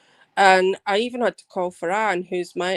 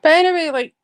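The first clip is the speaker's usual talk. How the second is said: indignant outburst; 9 semitones higher, 7 dB louder than usual. Real, not acted.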